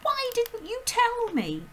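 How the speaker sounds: put-on high, loud, slow anguished child; high distressed little girl; breathless girl's cry. A narrator's reading. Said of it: "Matilda cried".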